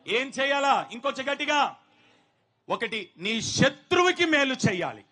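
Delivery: loud, ringing call to the church; fervent preaching. Real, not acted. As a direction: press the congregation to answer. Preacher calling congregation response. (బిగ్గరగా ప్రసంగం)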